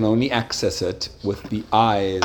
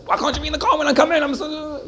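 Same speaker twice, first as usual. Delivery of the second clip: put-on much higher and fast, a stressed mother yelling at her kids; mock frantic mother; faster than usual. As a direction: rattle it off high and rushed like a frazzled mom.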